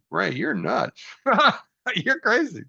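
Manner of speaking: breaking into a laugh mid-line, voice jumping up high; amused self-mockery, high and laughing; laughing at himself. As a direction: laugh through the put-down of yourself. Mock verdict on himself, laughing.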